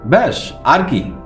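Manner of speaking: usual speaking voice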